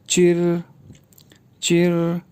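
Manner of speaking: चिर said with a retroflex ch and a curled r; चिर, tongue curled back; चिर like the Mandarin syllable zhi; pinyin zhi. Shown as a demonstration. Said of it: "इनको यूँ pronounce किया जाएगा".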